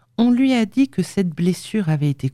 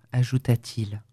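ordinary delivery, nothing marked

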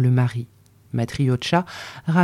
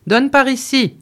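high, louder order to the servant; husband calls to the servant, pitched high; called out; 8 semitones higher, 3 dB louder than usual. A narrator's reading. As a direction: call the servant over sharply. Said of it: "cria-t-il au domestique".

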